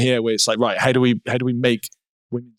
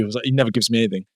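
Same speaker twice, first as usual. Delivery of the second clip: usual speaking voice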